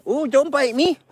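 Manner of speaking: high-pitched mock protest to an animal; pet-talk protest. Real, not acted. Man to a nipping bear, playful high protest. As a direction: coo a startled little don't-do-that.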